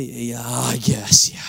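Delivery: slow, low, grudging "yeah"; reluctant giving in. Retold or imitated. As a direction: drag it out as if unwilling to agree. Reluctant answer to God.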